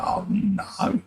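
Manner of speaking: breathy whispered oh no; whispered dismay. Retold or imitated. Wife reacts to the MRI fart, whispered dismay. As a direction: hush it like a shocked wife. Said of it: "She went".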